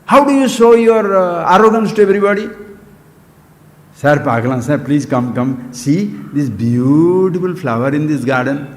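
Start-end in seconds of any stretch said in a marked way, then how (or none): 3.9-8.7 lightly acted invitation, pitched well below usual talk; low-voiced mock coaxing, drawing out "beautiful". Speaker acts out inviting a sir to see a garden flower, low coaxing.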